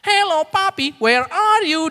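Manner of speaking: suara anak tinggi memanggil papinya; suara anak kecil. Retold or imitated. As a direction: tirukan anak kecil dengan suara jauh lebih tinggi. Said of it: "dia semua masuk". (anak dua tahun memanggil papinya saat meeting, suara anak tinggi)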